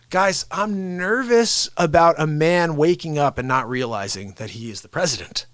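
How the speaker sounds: ordinary talk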